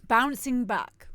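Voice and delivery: a light, slowed touch of the comic presenter; lightly put-on smug broadcaster punchline; hint of a cheesy TV host; Alan Partridge. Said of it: "Like, A-, Alan Partridge".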